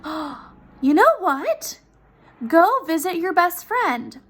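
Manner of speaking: lightly voiced husband with a bright idea; eager husband. Husband suggesting a plan to his wife, eager and slow.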